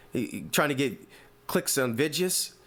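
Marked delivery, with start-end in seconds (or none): 1.9-2.5 "Vidyas" said vid-juhs, for videos; mimicked old-time pronunciation. Host quotes the old coach on clicks, mimicked pronunciation of "Vidyas". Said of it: "That's how you know that boy old".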